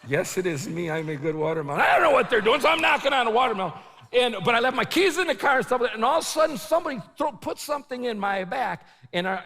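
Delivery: ordinary talk, nothing marked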